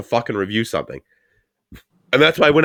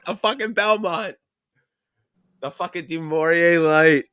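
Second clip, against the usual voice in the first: mock Canadian accent, slow and high; hammed-up Canadian brand names; 5 semitones higher, slower than usual. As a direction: drawl it like an exaggerated Canadian. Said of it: "You've never sounded more Canadian".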